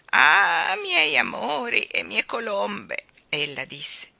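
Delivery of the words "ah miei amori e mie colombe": put-on slow, tender old voice to her balloons; loving old woman. Old woman speaks lovingly to her balloons, old quavering voice. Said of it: "guardò amorosamente i palloni".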